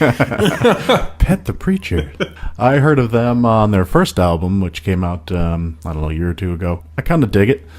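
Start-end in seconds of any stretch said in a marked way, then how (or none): 0.0-2.1 slow, high, laughing through the name. Real, not acted. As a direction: laugh as you say the name again. Repeats name while laughing.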